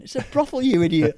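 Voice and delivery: loud, high-pitched retold scolding; exasperated put-down. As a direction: imitate a companion setting a fool straight. Retells companion's exasperated put-down.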